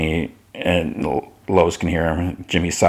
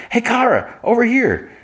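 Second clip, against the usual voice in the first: much higher calling-out voice; high calling Lois. Retold or imitated. Lois calls Kara over at the gala, high put-on call. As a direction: wave her over in a lifted, put-on voice. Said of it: "Lois like".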